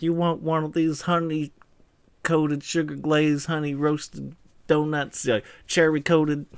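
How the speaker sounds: put-on slow, drawn-out Southern accent. Donut lover offering a honey-coated treat, slow Southern accent. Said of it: "pretty decent Southern accent".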